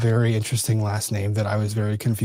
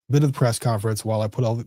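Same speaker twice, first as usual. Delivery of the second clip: ordinary delivery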